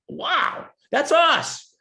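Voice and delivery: light mock amazement at seeing themselves; delighted surprise. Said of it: "they just went like".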